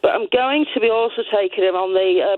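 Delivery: ordinary talk, nothing marked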